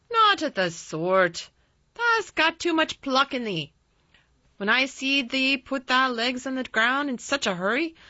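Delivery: put-on loud old Yorkshire gardener; drily triumphant, in broad dialect; dry exultation. A narrator's reading. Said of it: "he said with a dry exultation".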